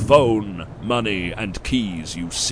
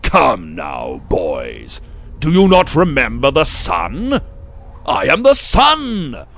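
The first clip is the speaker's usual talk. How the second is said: thundering elder; 7 semitones higher, 9 dB louder than usual. Acted. Elder booms at the boys that he is the sun. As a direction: proclaim yourself the sun.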